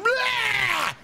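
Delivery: high, hammed-up pouncing yell; monster-like "blah". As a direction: leap out roaring "blah". Retells leaping out of bush.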